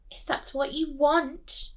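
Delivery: high slow sugary yielding; other mother. Acted. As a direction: let her go, sweetly, slowly.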